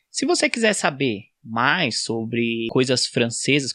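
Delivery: ordinary talk, nothing marked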